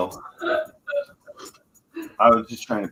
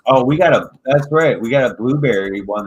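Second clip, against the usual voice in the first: excited; 5 semitones higher, 9 dB louder than usual. Real, not acted. Loud excited announcement.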